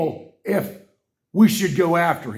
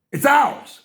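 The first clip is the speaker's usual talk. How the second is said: higher and louder; indignant shout; 11 semitones higher, 5 dB louder than usual. Real, not acted. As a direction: insist hotly. Indignant claim.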